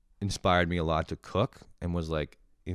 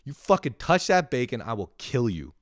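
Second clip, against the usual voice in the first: louder and higher, hammed-up warning; mock threat; 7 semitones higher, 8 dB louder than usual. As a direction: snap at the sister to keep off. Threat to sister over bacon.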